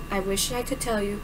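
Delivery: soft, low regretful murmur; quiet regretful voice. Acted. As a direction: say it sadly under your breath. Brody's quiet reply.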